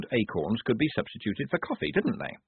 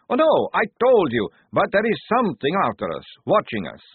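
agitated; 9 semitones higher, 7 dB louder than usual. A narrator's reading. Maledict agitated, high and loud.

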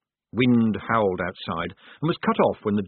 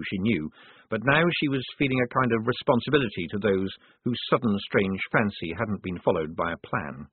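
usual speaking voice

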